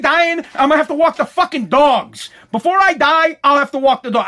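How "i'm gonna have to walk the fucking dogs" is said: usual speaking voice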